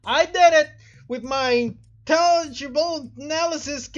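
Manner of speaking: normal talking voice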